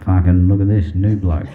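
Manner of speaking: low, loud Aussie bloke; Australian God. Acted. Australian God spotting a new soul, deep loud voice. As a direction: boom it deep in broad Australian. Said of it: "a soul comes up to heaven and he's".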